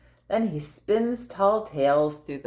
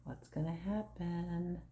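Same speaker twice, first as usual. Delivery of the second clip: hushed, breathy suspense question; suspense whisper; 14 dB quieter than usual. Acted. Parent teasing the child before the sneeze, whispered.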